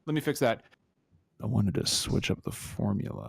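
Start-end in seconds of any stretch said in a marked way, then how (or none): 1.4-3.3 low, husky radio voice; deep sultry voice. Acted. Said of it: "deep and sultry voice".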